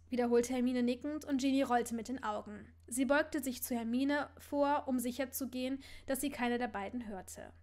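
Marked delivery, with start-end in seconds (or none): none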